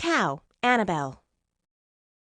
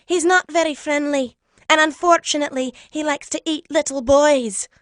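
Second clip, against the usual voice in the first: high, loud giant lady's voice; higher-pitched cautioning giantess; kindly giantess warning; 5 semitones higher, 7 dB louder than usual. A narrator's reading. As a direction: warn him like a friendly giantess. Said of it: "she warned".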